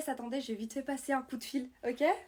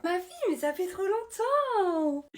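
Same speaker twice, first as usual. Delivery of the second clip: loud, slow, stretched-out delight; overjoyed drawn-out greeting; gushing reunion; 5 semitones higher, 6 dB louder, slower than usual. Acted. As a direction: squeal it like you haven't seen her in years. Reunion with an old friend.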